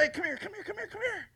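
high, urgent repeated beckoning; calling him over. Retold or imitated. Preacher beckoning the huffing bullfighter, high retold calling. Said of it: "I called him over".

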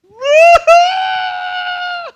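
high, loud wailing mock sob; hammed-up ugly-cry wail for laughs; loud high comic bawling sound. Anybody ever do one of them ugly cries?.

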